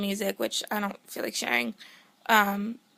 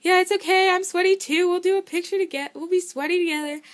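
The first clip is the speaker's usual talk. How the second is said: a light, much higher take on the easygoing guitarist; imitating the guitarist; 9 semitones higher, 4 dB louder than usual. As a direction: toss it off as the chill guitarist, voiced up high.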